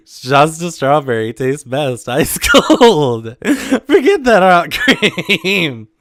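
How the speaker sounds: slow words pushed out through laughter; fighting laughter to finish; cracking up, loud and slow; laughing slowly. Real, not acted. Laughing through reading letter.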